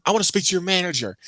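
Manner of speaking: high, quick mock-irate customer; indignant demand. Customer demanding the usher's boss, indignant. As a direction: imitate a customer making a huffy demand.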